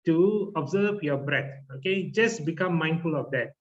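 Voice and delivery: normal talking voice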